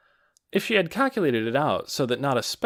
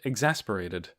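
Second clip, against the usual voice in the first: ordinary talk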